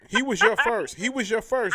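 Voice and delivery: loud, high teasing yell; teasing shout. Real, not acted. Friend loudly teasing her about her first love, a high playful yell.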